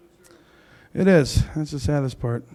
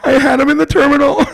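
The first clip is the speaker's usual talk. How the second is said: frantic passenger; 12 semitones higher than usual. Acted. Comic as frantic passenger begging the plane crew. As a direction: play a hysterical flyer who lost her pet.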